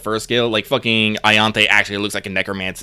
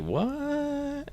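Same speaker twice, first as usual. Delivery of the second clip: shocked what; 15 dB quieter than usual. Retold or imitated. Everyone's shocked reaction.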